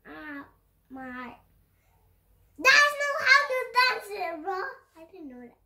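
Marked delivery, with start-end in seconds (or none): 2.6-4.5 higher and louder, scolding a friend. Real, not acted. Gamer scolds his teammate loudly for playing wrong. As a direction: call him out loudly.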